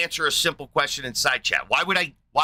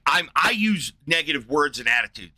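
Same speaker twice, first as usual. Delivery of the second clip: normal talking voice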